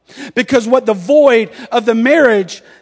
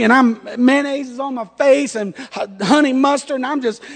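ordinary talk, nothing marked

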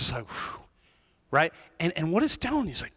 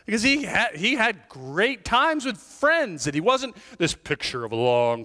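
ordinary talk, nothing marked

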